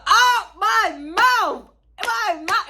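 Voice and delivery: high, slow, drawn-out shock; jaw-dropped slow outcry; stunned disbelief. Real, not acted. Disbelief exclamation.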